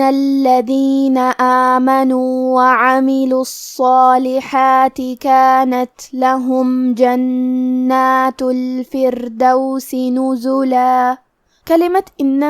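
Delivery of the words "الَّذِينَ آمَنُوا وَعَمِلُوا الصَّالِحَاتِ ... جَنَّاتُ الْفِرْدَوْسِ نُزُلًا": slow, measured tajweed recitation; Quran recitation. Shown as a demonstration. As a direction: recite the verse slowly and melodically. Teacher recites Quran verse. (آہستہ، ترتیل کے ساتھ قرآنی تلاوت)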